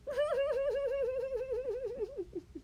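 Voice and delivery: high squealing laugh. Real, not acted. Laughs at porn joke.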